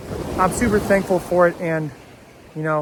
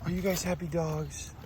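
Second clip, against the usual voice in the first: soft praise for dogs; 9 dB quieter, slower than usual. Real, not acted.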